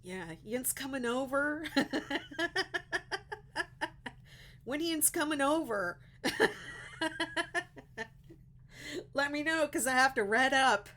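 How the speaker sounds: can't stop cracking up at her own joke; laughing. Real, not acted. Telling viewers Ian is visiting, laughing through it. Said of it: "I'm cracking myself up way too hard".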